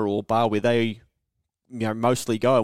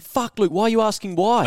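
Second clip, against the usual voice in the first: high, self-scolding inner voice; scolding himself; 10 semitones higher, 4 dB louder than usual. Retold or imitated. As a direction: tell yourself off in disbelief. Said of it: "But I was inquisitive".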